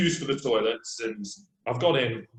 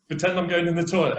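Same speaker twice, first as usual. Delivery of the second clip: usual speaking voice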